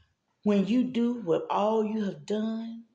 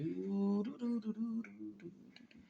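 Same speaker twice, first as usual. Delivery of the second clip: fading nagging do; 14 dB quieter than usual. Retold or imitated. The endless nagging do she hears in bed, fading to a whisper. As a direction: do do do dying away to a whisper. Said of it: "I ain't hearing nothing but do".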